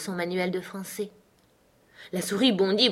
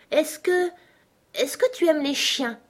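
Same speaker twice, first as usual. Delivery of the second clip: put-on very high, hesitant little-girl question; tentative Alice; 8 semitones higher than usual. Alice changes the subject with the mouse, high tentative question. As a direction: venture it high, restarting halfway.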